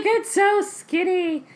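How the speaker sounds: high, slow delighted squeal; excited squeal. Retold or imitated. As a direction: gush it out in delight. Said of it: "I'm like".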